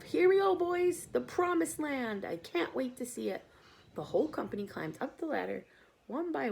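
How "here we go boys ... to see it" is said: high, loud eager shouts; excited shouts. Acted. Crew shouts while climbing the ladder, high and loud. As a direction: cheer like heading to the promised land. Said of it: "shouts of".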